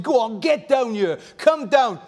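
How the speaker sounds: high, sneering mock taunts; voicing the mocking crowd; taunting crowd. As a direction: jeer at the man on the cross. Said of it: "when they were taunting him, saying".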